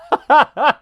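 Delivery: a sudden high laugh breaking out; high burst of laughter. Real, not acted. Laughing at the Ithorian pun, high burst of laughter. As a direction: laugh at your own joke, way up high.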